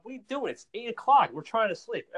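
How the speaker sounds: high-pitched imitation of an annoyed neighbor; mock complaining neighbor. Neighbor complains about mowing while they sleep, mock exasperation.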